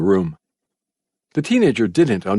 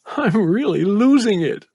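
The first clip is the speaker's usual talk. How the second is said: put-on high, slow boy's mutter; 11 semitones higher than usual. A narrator's reading. Boy mutters to himself in the dark bunker. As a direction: mumble it to yourself. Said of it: "He muttered".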